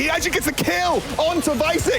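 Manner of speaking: high, fast hype casting; esports caster. Acted. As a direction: shout the teamfight like a caster. Hype call of teamfight.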